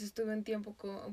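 normal talking voice

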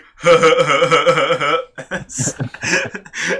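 loud, repeated "huh" noises breaking into laughter; imitated drunken noise. Retelling a drunk friend's door-kicking, loud imitated huh-huh noises. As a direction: mimic a drunk's repeated huffing, then crack up. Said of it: "just stood there going".

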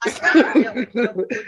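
loud laughing protest. Real, not acted. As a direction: blurt it out through laughter.

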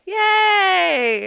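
loud, high cheer. Real, not acted. Cheering birthday.

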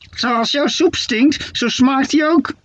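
a mock-offended old witch snapping back; offended witch shout. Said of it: "schreeuwde Steketee beledigd terug". (hoge, langzame beledigde heksenstem)

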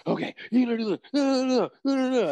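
high, loud, rushed corner instructions; frantic corner talk. Retold or imitated. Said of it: "Usually it's like".

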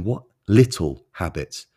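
LITTLE drawn out slowly and set apart; stress on LITTLE. Real, not acted. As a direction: lean on the word "little", taking it slowly. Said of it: "emphasis on the word little".

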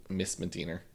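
ordinary delivery

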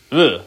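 short, loud grunt of revulsion; disgusted "ugh". Real, not acted. Disgust at the killer's looks.

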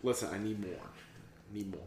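low, soft acted pleading; hushed mock desperation; low plea. Voicing someone begging for more coffee.

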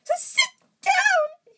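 drawn-out, very high loud elf wail. A narrator's reading. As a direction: wail slowly in a piercing falsetto. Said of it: "he wailed".

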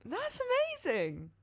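high, drawn-out amazement; amazed. Real, not acted. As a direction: marvel at it, slowly. Amazed at Welsh name.

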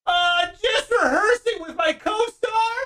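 high, strained, slow reply; high-pitched guilty cover-up; caught actor calling back. Acted. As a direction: call back from the trailer, caught out. Caught actor answers from trailer.

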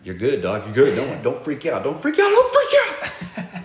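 comic escalating panic, ending in a drawn-out squeal; panicky self-reassurance. Retold or imitated. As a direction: build from calm to a high squeal. Acts out panicked self-reassurance before bed.

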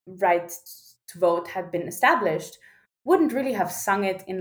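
usual speaking voice

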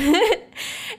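a sudden high laugh; high burst of laughter. Real, not acted. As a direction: laugh out in disbelief. Laughing at the notification.

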